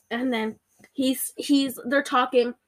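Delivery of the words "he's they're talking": usual speaking voice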